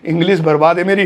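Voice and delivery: ordinary talk, nothing marked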